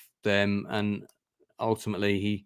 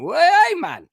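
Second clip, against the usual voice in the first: quick Geordie imitation, much higher and louder; Geordie accent; 8 dB louder than usual. Host showing how thick Geordie sounds, mock Geordie accent. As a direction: toss off a loud Geordie "Well, hey, man". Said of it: "if it's a thick Geordie accent".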